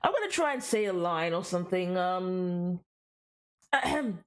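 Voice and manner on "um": ordinary talk, nothing marked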